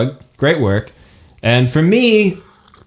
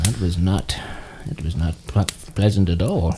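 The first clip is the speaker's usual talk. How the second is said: grim murmur; 7 semitones lower, 7 dB quieter than usual. Acted. DM voicing grim reaction.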